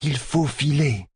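a Seanchan woman urging haste; Leilwin's voice. Acted. Leilwin urging Egwene in the dark.

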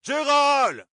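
Gérald crié très aigu et fort; hèle ton serviteur au loin; appel crié. A narrator's reading. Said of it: "cria-t-il". (Toram appelle Gérald en criant)

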